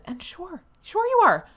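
high sarcastic sure you are. Acted. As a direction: drip with disbelief. Sarcastic reply to nepo claim.

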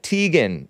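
"Teigen" as TEE-gen; long ee in "Teigen"; rejected pronunciation. Shown as a demonstration. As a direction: start "Teigen" like the word tea. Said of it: "Not Teigen".

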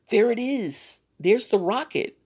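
high, loud cry of discovery; excited exclaim. Acted. The women bursting in, excited cry. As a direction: shout the find out.

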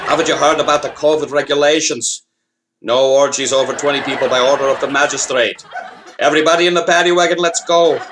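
put-on Irish cop accent; old-time Irish beat cop, Irish accent; 1930s beat cop. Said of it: "it probably goes a little something like this".

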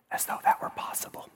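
unvoiced wry whisper aside; a hushed sarcastic aside. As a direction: breathe it out as a dry joke.

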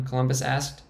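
usual speaking voice

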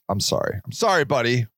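usual speaking voice

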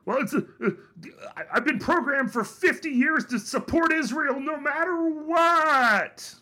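high, loud, far-from-natural hysterical voice; mock outraged rant. Host mocking a programmed Israel supporter, hysterical rant. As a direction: stammer into it, then build to a stretched-out mock wail.

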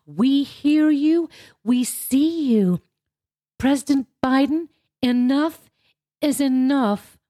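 slow, high-pitched mock senator; put-on high, breathy voice. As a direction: imitate a breathy woman speaking slowly and high. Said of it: "spoke in a very high voice".

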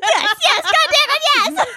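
high, loud hammed-up acceptance; mock eager yes. Mocking her co-host accepting Brad Pitt, high and loud. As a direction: shout yes like a desperate fiancée. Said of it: "She'd be like".

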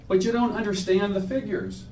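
high, condescending council voice; patronizing official. Acted. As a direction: talk down like a polite official. Council member quoted in poem.

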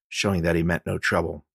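usual speaking voice

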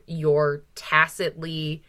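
normal talking voice

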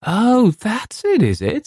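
high, drawn-out patronising coo; slow, high flattery, falling back at the end; flattering. A narrator's reading. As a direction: humour him in a flattering, high voice. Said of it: "said Louis in that flattering voice".

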